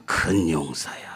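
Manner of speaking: whisper. Acted. Angel calling the frightened Gideon, hushed whisper. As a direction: breathe the title like a secret.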